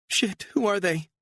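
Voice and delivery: high, alarmed cry; panicked woman. A narrator's reading. Woman seeing the pursuers in the mirror, high and panicked. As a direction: spot them and panic. Beatrice voiced by narrator.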